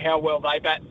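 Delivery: normal talking voice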